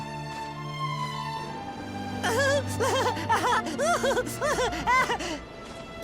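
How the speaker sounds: gloating devilish laugh; villainous laugh. Acted. Devil pleased with his mischief, gloating laugh.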